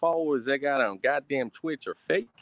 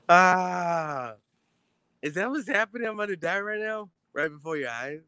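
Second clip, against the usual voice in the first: mock terror.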